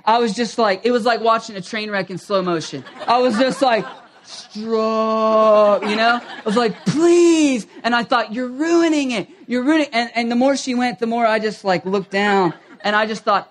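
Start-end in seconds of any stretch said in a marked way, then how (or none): 4.2-5.8 long drawn-out, louder plea; mock-desperate, stretch the word out loud; exasperated plea. Silently begging wife to stop. 6.9-7.7 loud, high, stretched-out begging; comically beg, dragging out the word; desperate plea. Retold or imitated. Silently begging wife to stop. 8.3-9.9 higher-pitched mock despair; exasperated accusation. Silently blaming his crying wife.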